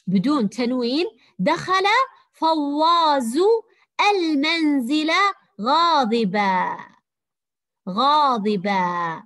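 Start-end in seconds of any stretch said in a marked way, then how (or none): 1.4-6.8 slow, loud model reading with غاضبا ending in a long open "aa"; alif ending, no tanween. Shown as a demonstration. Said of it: "لو نطقناها ألفا بدون تنوين". 7.9-9.2 غاضبا slow, ending in a long "aa" with no n; drawn-out, lower غاضبا closing on a bare long vowel; alif ending, no tanween. Shown as a demonstration. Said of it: "إذا قرأناها بدون تنوين، قرأناها ألف".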